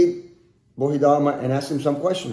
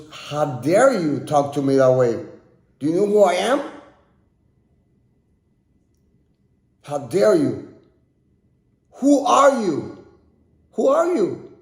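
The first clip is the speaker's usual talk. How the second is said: angry emperor. Retold or imitated. Angry emperor voiced in story.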